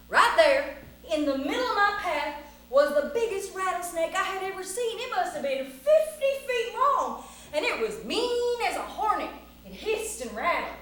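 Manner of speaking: high, big tall-tale telling; excited story narration. A narrator's reading. Storyteller describes the biggest rattlesnake ever, excited narration. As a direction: stretch the key words with relish. Narrates meeting the giant snake.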